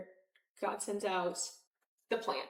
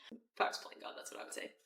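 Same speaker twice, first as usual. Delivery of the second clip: whispered aside; 10 dB quieter than usual. Real, not acted. Joking aside about playing God.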